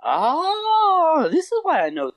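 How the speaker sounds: high, loud aha cry; triumphant realization; retold moment of discovery; eureka. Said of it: "And I'm like".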